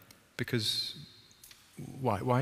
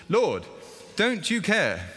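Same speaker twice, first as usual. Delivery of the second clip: indignant complaint; 4 semitones higher than usual. Retold or imitated. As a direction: storm in and demand help. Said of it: "and she goes".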